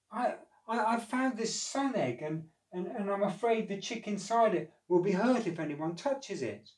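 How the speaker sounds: high, stammering worried elf; a little elf fretting over the egg; anxious elf. Acted.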